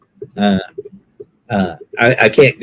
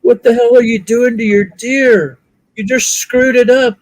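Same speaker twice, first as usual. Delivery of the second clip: mocking onlooker; 10 semitones higher than usual. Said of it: "somebody was like".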